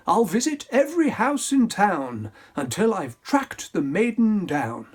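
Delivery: prince crying out. Acted. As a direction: announce it grandly. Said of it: "he cried".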